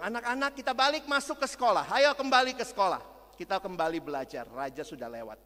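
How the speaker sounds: a lightly voiced teacher summoning the class; teacher calling the kids. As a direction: round up the pupils cheerfully. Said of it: "gurunya bilang".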